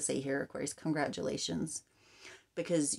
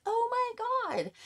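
high, slow, disbelieving "oh my God"; stunned gasp; slower than usual. Retold or imitated. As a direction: play it like the news just floored you. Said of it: "And you're like".

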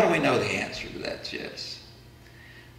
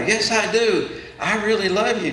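higher, slightly louder protest; acted girl's emphatic answer; louder insistence; 8 semitones higher, 4 dB louder than usual. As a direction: insist on it, drawing out the words. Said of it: "Sally answers a little louder".

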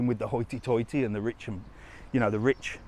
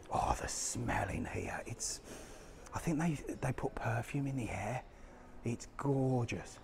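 hushed, breathy whisper in the shop; soft awed whisper; 10 dB quieter than usual. Real, not acted. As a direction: whisper as if not to disturb anyone.

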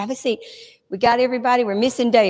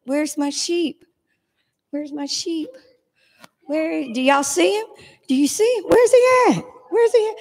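mock searching; 7 semitones higher than usual. Mock searching for lost toy sheep.

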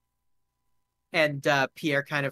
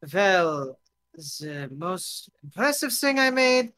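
tipsy foreign guest; 3 semitones higher, slower than usual. Acted. Drunk guest shows off his invention, proud and accented. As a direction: boast slowly in a foreign accent.

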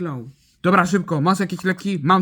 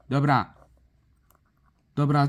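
ordinary delivery

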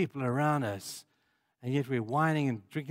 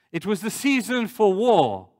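higher, louder, stress on "season"; emphatic quote; 6 semitones higher, 7 dB louder than usual. Real, not acted. Preacher quoting scripture about David, emphatic. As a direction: punch "season" high and hard.

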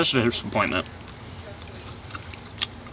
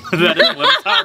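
laughing; 5 dB louder than usual. Real, not acted. Laughing while tasting candy.

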